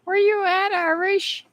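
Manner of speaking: high singsong call into the room. Real, not acted. Calling out for absent viewer.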